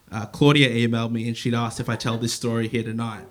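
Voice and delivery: ordinary talk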